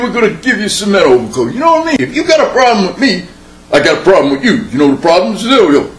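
tough New York street guy, lightly voiced; New York accent, a hint of a mobster threatening a stranger. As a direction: streetwise New Yorker accent, half-joking menace. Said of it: "certainly the accents are different".

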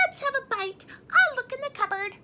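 high squeaky happy mouse voice; delighted mouse. A narrator's reading. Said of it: "said Mouse with delight".

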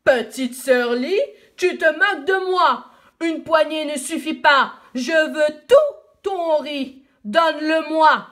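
angry roaring dragon; furious dragon roar. Acted. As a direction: bellow it in fury, demanding everything. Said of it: "il se fâche de plus belle et il rugit".

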